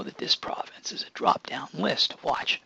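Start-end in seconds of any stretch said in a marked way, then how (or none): none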